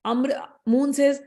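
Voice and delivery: usual speaking voice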